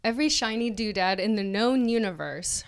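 usual speaking voice

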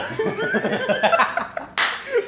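loud, high cackling laugh; real helpless laugh, high and loud; burst of laughter. Real, not acted. Cracking up at the duck joke, loud laughter.

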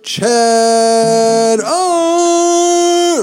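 booming ringmaster announcement. Acted. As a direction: announce him like a circus ringmaster. Announcer intro for guest.

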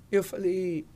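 usual speaking voice

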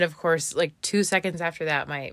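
usual speaking voice

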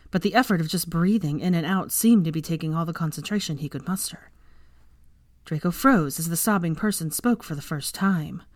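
usual speaking voice

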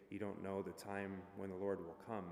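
ordinary delivery

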